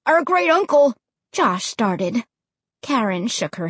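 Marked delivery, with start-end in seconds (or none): 0.1-0.9 put-on higher-pitched young boy's voice; protesting boy. A narrator's reading. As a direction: object in confusion.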